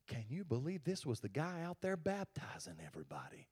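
hushed, gossiping whisper. Retold or imitated. Church folks gossiping about John, whispered. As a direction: lean in and whisper the scandal. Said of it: "they were whispering".

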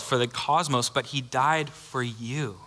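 ordinary talk, nothing marked

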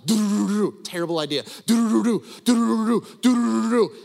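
loud sung machine-gun rat-a-tat; imitated gunfire. Preacher mimics the shootout for the youth group, loud rat-a-tat. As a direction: rattle it off like gunfire.

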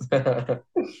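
a real, bright burst of laughter. Real, not acted. Host's joke about drunk impressions.

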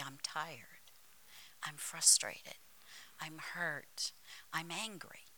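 low, subdued list of grievances; weary complaining. Acted. Preacher voicing what we say under attack, low and weary. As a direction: drop low and soft, as if worn down.

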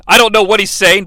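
usual speaking voice

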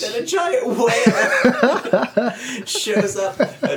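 high, loud, slow through real laughter; laughing hard. Real, not acted. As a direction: barely get it out for laughing. Cracks up telling whale's entrance.

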